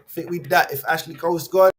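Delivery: ordinary delivery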